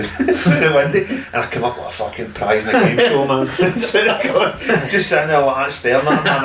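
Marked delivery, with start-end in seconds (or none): 0.0-1.2 slow, high, loud words broken by laughter; can't hold in the laughter; laughing. Real, not acted. Laughing while recalling Anne's face. 2.7-6.4 laughing. Real, not acted. Laughing at the memory.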